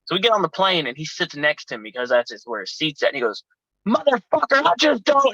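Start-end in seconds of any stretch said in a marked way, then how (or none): 3.9-5.3 high, loud angry outburst; going off. Retold or imitated. Mad friend to teller on the plane, angry outburst. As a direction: blow up at your seatmate. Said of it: "he starts going off on me".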